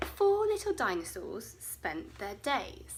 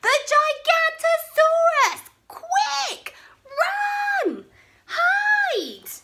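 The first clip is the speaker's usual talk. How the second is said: high, loud panicked alarm; cried out; 10 dB louder, much slower than usual. Acted. Bonehead yelling a false alarm to his friends, high and loud. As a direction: shriek the warning to scatter everyone. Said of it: "Bonehead cried".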